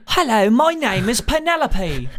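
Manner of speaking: high, slow, prim British English; teasing schoolbook English lessons in a British English accent; mock British English. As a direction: ham up a posh British English schoolbook line. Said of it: "britisk engelsk".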